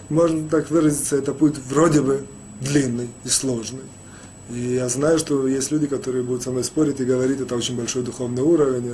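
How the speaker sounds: normal talking voice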